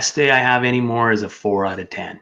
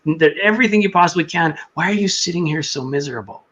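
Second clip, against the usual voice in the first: much higher pitched inner voice; little inner voice; 6 semitones higher than usual. Retold or imitated. Inner voice scolding him at the pharmacy, pitched high. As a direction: pitch way up like a tiny voice in his head. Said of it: "the little voice in my head goes".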